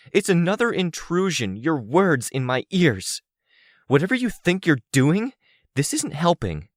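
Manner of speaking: high-pitched angry tirade, building; harsh, fervent anger. A narrator's reading. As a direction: let the accusations pour out with rising fervor. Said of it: "so I spoke with more fervor, more harshness in my voice".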